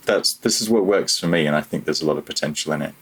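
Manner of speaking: normal talking voice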